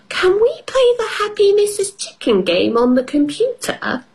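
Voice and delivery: put-on very high little girl voice; child voice. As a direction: ask it like an excited piglet. Peppa voiced in story.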